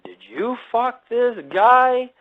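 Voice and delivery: high, slow, accusing; mock jealous accusation. Hiker accusing his girlfriend on the phone, jealous. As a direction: imitate a jealous boyfriend yelling.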